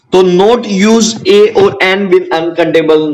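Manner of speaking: very slow, word by word; slow dictation. Shown as a demonstration. Teacher dictating to students, very slow. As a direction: spell the rule out for notes. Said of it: "teacher dictating rule slowly".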